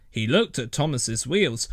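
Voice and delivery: usual speaking voice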